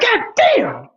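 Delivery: shocked fan at a quick knockout; stunned exclamation. Retold or imitated. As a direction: react like you just saw a five-second knockout. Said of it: "You're like".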